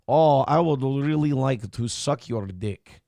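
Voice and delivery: slow, put-on Russian woman's accent; sultry Russian woman accent. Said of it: "I can't stand that fucking voice".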